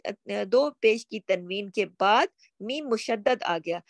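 usual speaking voice

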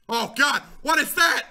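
sudden high, loud exclamation; startled alarm. Real, not acted. Player spotting something huge in the water, startled outcry. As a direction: blurt it out in alarm.

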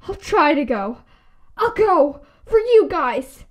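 ordinary talk

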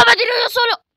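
high angry shout. Real, not acted. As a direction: yell it out. Shouting at own fall.

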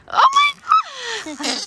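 sudden high-pitched shriek; shrieking in surprise. Real, not acted. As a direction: scream out in real shock. Toy squelches unexpectedly.

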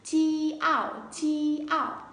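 "chi ảo" split into two drawn-out, high parts; split syllable. Shown as a demonstration. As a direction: sound out "chi ảo" piece by piece, pitched high. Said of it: "Các bạn đọc là". (đọc tách "chi ảo" thành hai phần, giọng cao)

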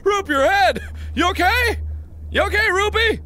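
high, loud worried cry; high alarmed call to a hurt friend; alarmed concern. Real, not acted. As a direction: rush to check he is hurt. Rupert hit his head.

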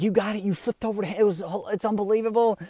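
high-pitched imitated amazement; thrilled cousin. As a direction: gush like an excited onlooker. Retold cousin thrilled at the crash.

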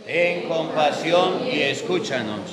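distant, soft crowd response in a church; a far-off congregation answering in a big hall; cathedral echo. Real, not acted.

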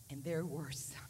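put-on whispered aside about the boys; breathy confiding whisper; hushed aside. Preacher whispering to the congregation about the boys.

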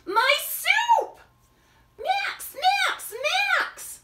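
greeting a lost friend; joyful shout. Acted. Friends see Max return.

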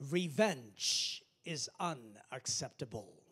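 very slow, stretched Revenge; slow, weighty. Acted. Pastor preaching to the church, slow and weighty. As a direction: lay every word down slowly.

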